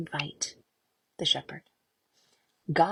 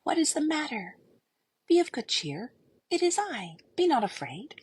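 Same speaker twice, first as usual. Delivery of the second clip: higher, bright reassuring shepherd; cheery and strong; 7 semitones higher than usual. Acted. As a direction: cheer her up with strength. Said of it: "He sounded so cheery and full of strength".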